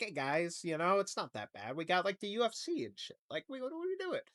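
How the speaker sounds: soft, hushed mock reassurance; a hint of a sheepish official smoothing things over; mock administration spin. Administration telling citizens it's not that bad, hushed mock reassurance. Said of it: "be like".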